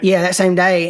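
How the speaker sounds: ordinary talk, nothing marked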